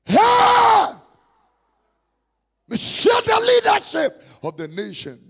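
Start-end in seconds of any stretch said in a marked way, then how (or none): 0.0-4.2 sudden very loud, high cry; loud, high preacher's proclamation; shout. Acted. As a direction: fire it out at the congregation. Preacher crying out to the crowd.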